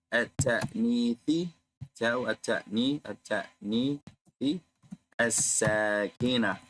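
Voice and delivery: "at-ta'nithi" and "as-sakina" drawn out slowly, each syllable clear; slow drill. Shown as a demonstration. Teacher drills the letter's name for students, slowly. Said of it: "The ta has a name".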